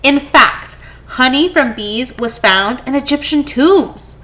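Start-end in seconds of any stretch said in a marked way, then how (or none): none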